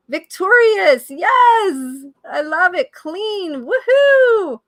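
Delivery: loud, high, thrilled cheers; excited cheering. Real, not acted. Coach cheering viewers' answers, loud and thrilled. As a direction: whoop it up for the audience.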